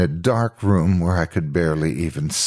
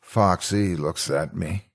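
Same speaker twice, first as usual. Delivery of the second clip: ordinary delivery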